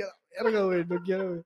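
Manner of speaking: hushed, frightened under the breath; scared hushed mutter. Acted. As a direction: mutter it low like you're hiding. Voicing the frightened hiding kid.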